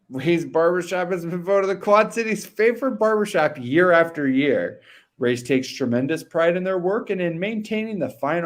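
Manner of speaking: punchy, sing-song commercial read; fake announcer voice. Co-host reading a barbershop ad on air, fake announcer voice. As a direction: sell it in a put-on ad announcer voice. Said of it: "read it better with a fake voice".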